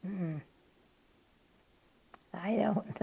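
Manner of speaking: ordinary talk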